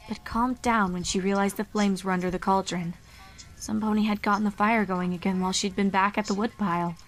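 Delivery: ordinary delivery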